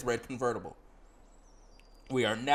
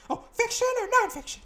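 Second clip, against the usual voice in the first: same question much higher, chirpy; high companion voice; 5 dB louder than usual. Acted. Companion asking the player about books, high voice. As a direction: ask it as the perky companion.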